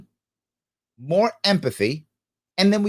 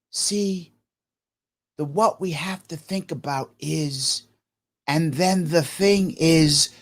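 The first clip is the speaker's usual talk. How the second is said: slow, soft, weighty pondering voice; somber guru; 4 dB quieter, slower than usual. Retold or imitated. Host mocking how gurus talk, somber and slow. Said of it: "they always talk the same way".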